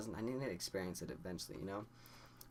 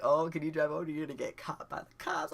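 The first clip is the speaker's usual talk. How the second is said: higher, louder teasing voice; mock teasing friends; 7 semitones higher, 5 dB louder than usual. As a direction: scare him playfully. Friends tease about cops.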